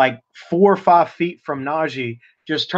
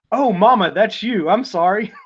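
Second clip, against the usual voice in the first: higher, lightly voiced apologetic son; sheepish son; 7 semitones higher than usual. Player apologizing to his mother, mild sheepish voice. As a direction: own up to mama a bit sheepishly. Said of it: "you can hear him say".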